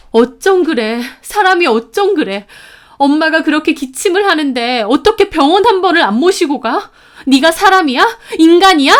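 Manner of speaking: high, loud outraged scolding; furious accusation. A narrator's reading. Sister scolding brother over their coughing mother, furious accusation. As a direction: berate him, building to a yell.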